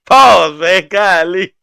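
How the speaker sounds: loud, high words through real laughter; can barely get it out for laughing; cracking up at a friend's joke, talking through the laughter; laughing hard. Real, not acted.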